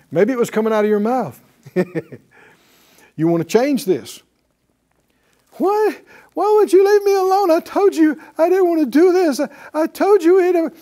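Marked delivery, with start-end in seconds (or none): 5.5-10.7 high, loud whining complaint; whiny complaining Israelites. Retold or imitated. Preacher mimics Israelites whining at Moses, high and loud. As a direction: whine like ungrateful people blaming Moses. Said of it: "hear the tone of that".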